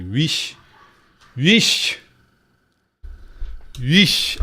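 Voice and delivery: "wish" drilled with a rising lilt; practised pronunciation. Shown as a demonstration. Conlanger practising their word aloud, "wish" pronounced carefully with a rise. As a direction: say "wish" as a foreign word being practised, pitch lifting. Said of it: "gotta learn to pronounce it correctly".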